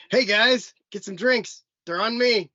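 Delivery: high, loud, eager; hyped generous bro; imitating hyped buddy. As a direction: shout it like a generous friend. Buddy voiced in story.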